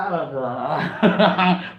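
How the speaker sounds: long chuckle trailing away; relieved, amused laugh. Real, not acted. Streamer finishes the poem for viewers, laughing.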